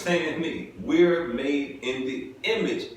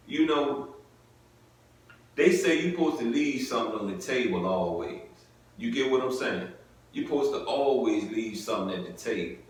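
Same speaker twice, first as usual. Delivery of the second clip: ordinary delivery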